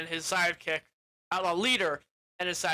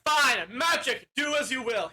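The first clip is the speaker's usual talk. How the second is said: faked incantation; 6 semitones higher, 4 dB louder than usual. Wizard faking magic for the outlaws, hammed-up. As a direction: proclaim a spell with put-on grandeur. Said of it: "He's, like, faking it".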